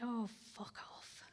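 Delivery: hushed, voiceless fuck off; a muttered inner swear under the breath; whispered retort. Retold or imitated. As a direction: whisper it like a private thought. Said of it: "And I think".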